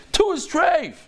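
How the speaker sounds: high, emphatic acted retort; mad retort. Rabbi dismissing the question about two, mad. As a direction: pitch it way up, annoyed and emphatic. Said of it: "He wouldn't say it like that".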